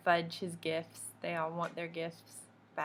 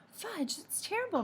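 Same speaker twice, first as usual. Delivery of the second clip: high-pitched mock scolding; mother's reproach, pitched well up; 13 semitones higher than usual. A narrator's reading. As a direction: scold a small child in a high voice. Mother scolds Fudge in story.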